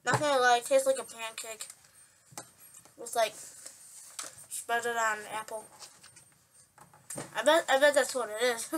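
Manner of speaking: words blurred by chewing; chewing while talking. Real, not acted. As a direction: mouth partly full of food. Seen 0: holds snack bar, bit at -5, cheeks full while talking.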